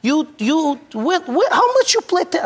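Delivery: high, stammering disbelief; stunned coach. Retold or imitated. The Eastern European coach, stunned that [name] never played, splutters high. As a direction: splutter in shock, voice shooting up high. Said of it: "he looks at me, he's like".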